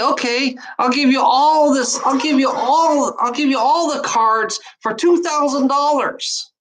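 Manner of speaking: mock tarot reader upping the price; greedy psychic. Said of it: "And then I say".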